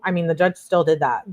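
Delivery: usual speaking voice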